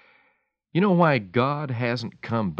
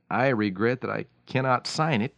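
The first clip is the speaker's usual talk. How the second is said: ordinary talk, nothing marked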